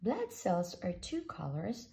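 usual speaking voice

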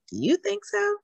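squeaky high playful question. Acted.

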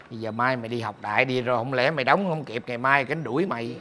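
ordinary delivery

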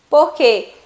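normal talking voice

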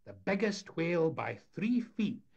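high, loud fairground patter; showman's announcement. A narrator's reading. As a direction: proclaim the attraction to a paying crowd. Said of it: "Sonny Jim announced".

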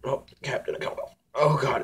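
ordinary talk, nothing marked

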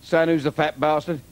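loud punchy club comic patter; stand-up delivery. Acted. Old Cockney comic working the crowd, loud punchy patter. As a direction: belt the gags out to the room.

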